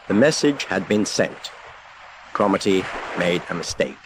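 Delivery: usual speaking voice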